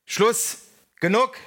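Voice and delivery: two high, abrupt exclamations; sharp call. A narrator's reading. Thick-shouldered leader halts the brawl, calling out sharply. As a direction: fire off each word like a shot. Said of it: "rief der Dickschultrige".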